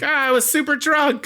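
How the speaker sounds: high-pitched imitation of an excuse; exaggerated, high, sheepish confession; mock defensive whine. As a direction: protest in a hammed-up high voice. Acts out man's defensive excuse.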